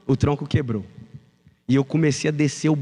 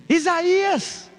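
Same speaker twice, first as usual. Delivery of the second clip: much higher, drawn-out shouted call of the full name; mother yelling full name; 11 semitones higher, much slower than usual. Retold or imitated. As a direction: call the boy's whole name like an angry mom. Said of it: "minha mãe grita". (mãe gritando o nome completo do filho, bem agudo e arrastado)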